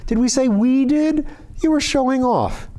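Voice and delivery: Jenny scolding Rennie, indignant. A narrator's reading. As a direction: scold him for showing off.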